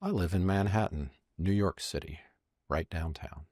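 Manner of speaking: soft and unhurried, falling away; quiet imitation of a director's personal delivery; one-to-one address. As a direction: confide it to one listener. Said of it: "He looks at the second person".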